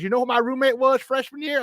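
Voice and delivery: high-pitched imitation of a North Carolina accent; North Carolina coach accent. Former player mimics coach hailing him, North Carolina accent. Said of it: "he talks like this".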